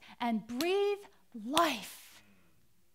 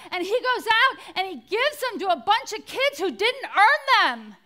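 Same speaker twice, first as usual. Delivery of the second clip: high, loud, ringing sermon delivery; full-force preaching; 6 semitones higher, 6 dB louder than usual. Real, not acted. Preacher praising Franklin's generosity to the congregation, impassioned and loud. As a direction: build it up like a rousing sermon.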